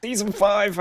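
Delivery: high, loud burst of excitement; excited exclamation. Real, not acted. Reacting to friend's news about season five, excited.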